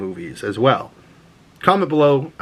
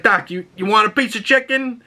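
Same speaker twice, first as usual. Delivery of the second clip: higher, lightly put-on chauffeur voice; the driver offering chicken; 10 semitones higher than usual. Retelling Green Book, driver offers Doc chicken, light put-on voice. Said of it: "He's like".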